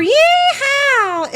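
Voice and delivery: high, hollered hillbilly whoop; hammed-up, high-pitched country holler; mock cowboy yeehaw. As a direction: let out a parody rodeo yell. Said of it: "You don't hear".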